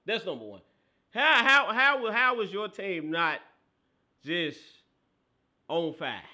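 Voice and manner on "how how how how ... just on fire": high, loud, stretched-out demand; incredulous anger. Real, not acted. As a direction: keep hammering the question, slow and loud. Pissed rant at the losing coach.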